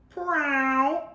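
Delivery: high little cat sound; cat's reply. A narrator's reading. Cat answering Miss Tilly, high little mew-like word. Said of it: "replied Oliver".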